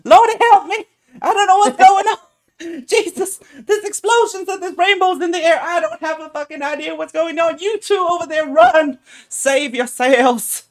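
shrieking high panicked woman; panicked halfling woman. Acted. Halfling woman running from the explosion, shrieking in panic. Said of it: "And she's like".

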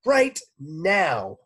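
sharp high loud yell; teacher yelling at a pupil for touching her notebook. A narrator's reading. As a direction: snap out a loud command. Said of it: "yelled Missus Drizzle".

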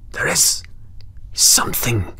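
goblin's secret, breathed with no voice; whispered. Acted. Goblin confiding in the Earl how to save his friend, in a whisper. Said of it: "whispered the goblin".

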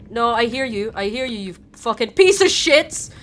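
loud cursing at the screen; frustrated yell at a game; angry outburst. Real, not acted. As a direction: snap at them, furious.

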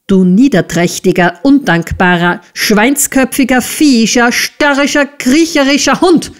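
string of insults climbing in pitch; shrewish scolding. A narrator's reading. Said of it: "keifte Misses Squeers".

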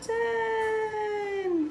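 put-on slow, drawn-out counting for children; teaching a child to count. Shown as a demonstration. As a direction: count each number slowly. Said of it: "I'm gonna make ten dots".

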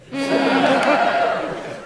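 big loud laughing burst. Real, not acted. Comic laughing at his own punchline, loud.

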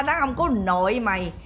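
ordinary talk, nothing marked

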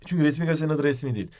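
ordinary delivery

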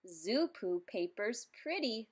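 ordinary delivery, nothing marked

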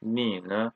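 ne light and short after low Ni; Ni ne with a toneless, light ne; neutral tone. Shown as a demonstration. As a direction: let ne fall unstressed after Ni. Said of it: "it's neutral tone".

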